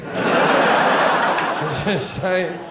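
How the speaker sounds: slow, breathy laughing repeat. Real, not acted. Comedian repeats his line laughing at the audience. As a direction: laugh through the words.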